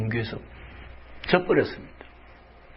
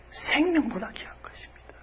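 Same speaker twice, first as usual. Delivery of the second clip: whisper; 12 semitones higher, 6 dB quieter than usual. Real, not acted. Whispered conviction.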